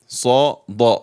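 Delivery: teacher sounding out "Sa" then "Da", each consonant crisp; contrasting Sa and Da. Shown as a demonstration. Teacher drills Arabic letters Sa and Da for learners. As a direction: spell out "Sa, Da" slowly for students. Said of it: "So Sa and Da".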